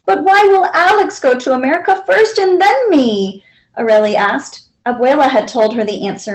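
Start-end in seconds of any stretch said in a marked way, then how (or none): 0.1-3.4 put-on higher, puzzled child's voice; a lightly voiced child; little girl asking. A narrator's reading. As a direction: ask like a confused little girl. Child asks grandmother.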